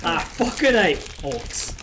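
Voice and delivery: put-on loud, slow Snatch accent, dropped aitches; emphatic curse in an accent out of Snatch; Snatch-style accent. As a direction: draw it out loudly in a Snatch accent. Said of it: "That's the accent I'm doing".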